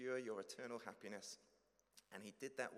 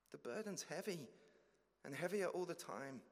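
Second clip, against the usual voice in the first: higher, louder pleading believer; anxious confession; 7 semitones higher, 3 dB louder than usual. Acted. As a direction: plead nervously, afraid of rejection.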